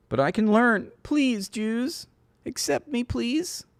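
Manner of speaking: hammed-up begging to be accepted; mock pleading. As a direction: grovel in a put-on high voice.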